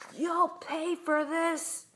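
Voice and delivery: high, stretched-out threat; angry cry. A narrator's reading. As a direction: cry out vengefully, dragging each word. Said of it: "he cried".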